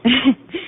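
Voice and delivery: brief amused laugh; a quick soft chuckle; a little laugh at the doctor's joke; short laugh. Acted.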